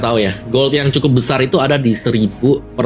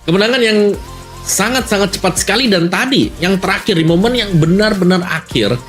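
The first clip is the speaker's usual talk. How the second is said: excited commentary; 8 semitones higher than usual. Real, not acted. Co-caster reacting to the win.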